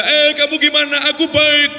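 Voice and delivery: loud, high-pitched rural hollering; villagers' shouting. Shown as a demonstration. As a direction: call out a greeting as if across fields. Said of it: "kalau ngomong teriak-teriak".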